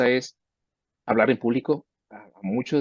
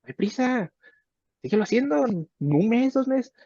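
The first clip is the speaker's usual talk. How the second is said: much higher, airy coaxing voice; patient mentor voice; 12 semitones higher than usual. Retold or imitated. Mentor telling a student there's no hurry, much higher coaxing. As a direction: coax gently, no rush at all. Teacher voicing patient encouragement.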